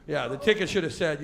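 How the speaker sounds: normal talking voice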